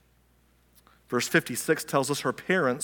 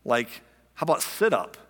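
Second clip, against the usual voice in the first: ordinary talk, nothing marked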